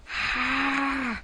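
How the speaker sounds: a sharp high strangled "Ah"; father's anguished cry. Retold or imitated. Father coming home jobless, crying out in despair. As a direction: cry out like a man at the end of his rope. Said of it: "and he went".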